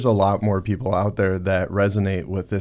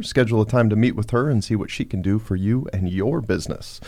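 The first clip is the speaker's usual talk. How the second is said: ordinary talk, nothing marked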